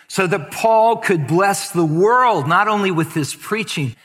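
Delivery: praying for the congregation, earnest thanksgiving; closing prayer. Acted. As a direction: pray aloud with grateful fervor. Closing prayer over music.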